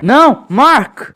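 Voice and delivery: anguished cry of no; shout. Acted. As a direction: yell it to stop him. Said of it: "O doutor só teve tempo de gritar".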